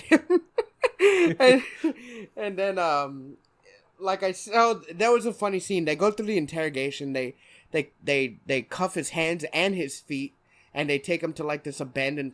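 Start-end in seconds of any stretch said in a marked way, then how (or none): none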